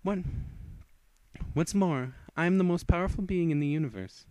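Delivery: loud, high and grand; boastful vampire. Acted. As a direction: proclaim your supremacy. Character boasting of her power.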